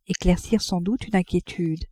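ordinary delivery

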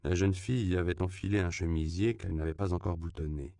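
ordinary delivery, nothing marked

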